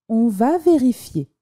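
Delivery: plain reading voice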